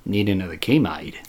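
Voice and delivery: low, very soft Australian reply; Australian accent. Retold or imitated. Customer discovers the fake accent, low quiet Australian accent. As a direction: imitate a deflated Australian customer. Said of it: "he kinda pauses".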